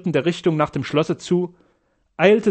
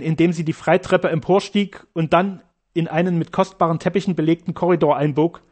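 ordinary delivery

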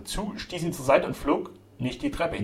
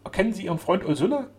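raised, pleading address; urgent appeal; 7 semitones higher, 4 dB louder than usual. A narrator's reading. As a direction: implore a friend to recognize you. Duke appeals to sick friend.

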